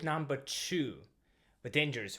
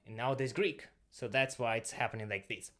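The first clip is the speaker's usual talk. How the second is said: ordinary talk, nothing marked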